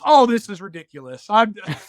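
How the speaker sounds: exasperated son. Retold or imitated. Teenage son fed up with dad's tears, loud exasperated mock. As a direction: throw up your hands and quit. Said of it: "he goes".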